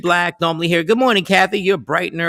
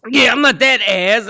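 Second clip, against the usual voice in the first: ordinary talk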